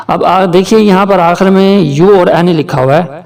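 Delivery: usual speaking voice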